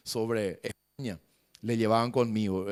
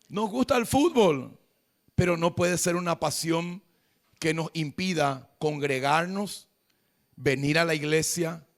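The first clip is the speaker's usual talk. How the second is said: high, loud preacher's cry; preaching at full force; 4 semitones higher, 4 dB louder than usual. Acted. Preacher proclaiming to his congregation, loud and high.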